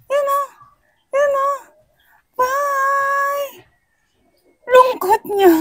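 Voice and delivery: very high squeaky cooing; high baby-talk voice. Pretend. Teasing the sad little nephew with byes, baby talk. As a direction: tease the child in a tiny high voice. Teases the motherless crying child.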